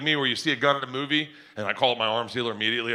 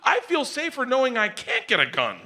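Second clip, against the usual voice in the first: high, giddy squeal; acted hysterical laughing; 12 semitones higher than usual. Buyer telling dealer he feels safer, hysterically giddy. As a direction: speak as if overcome with hilarity. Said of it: "laughing hysterically. I was like".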